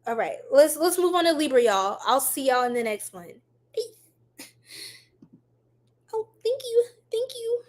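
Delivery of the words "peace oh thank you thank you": high soft sign-off. Real, not acted. Signing off.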